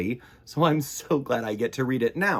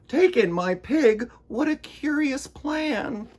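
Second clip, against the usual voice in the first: put-on puzzled old lady; 12 semitones higher, 3 dB louder than usual. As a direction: ask it in disbelief, high and fussy.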